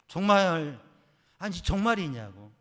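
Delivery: loud, disbelieving; incredulous question. Retold or imitated. Asking his wife again, stunned and loud. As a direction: press her hard, can't believe it.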